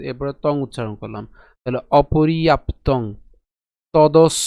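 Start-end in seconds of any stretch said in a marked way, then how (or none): 2.0-3.0 অপর্যাপ্তং slow, split after প; slow, careful অপর্যাপ্তং; pronunciation demo. Shown as a demonstration. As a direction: say অপর্যাপ্তং as o-po-ri-ap, then tong. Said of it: "প পর্যন্ত উচ্চারণ করেছি".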